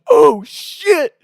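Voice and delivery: high, loud startled oh shit; sudden panic as dad charges in; panicked. Retold or imitated. Panicked friend as dad arrives.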